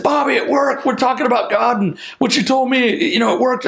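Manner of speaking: high-pitched retold excitement; imitating an excited phone call; excited report. Retold thrilled phone call to mentor.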